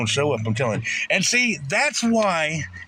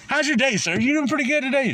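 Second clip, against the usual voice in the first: mock friendly officer; 8 semitones higher, faster than usual. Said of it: "imitating officer, higher".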